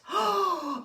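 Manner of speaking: high, drawn-in gasp; gasp of wonder. Acted. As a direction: gasp in delight at a lovely surprise. Storyteller's delighted gasp at cat.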